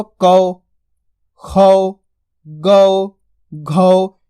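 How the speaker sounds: कौ, खौ, गौ, घौ one by one, long au; pronunciation drill. Shown as a demonstration. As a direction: drill कौ, खौ, गौ, घौ with pauses. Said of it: "आसानी के साथ उच्चारण कर लोगे".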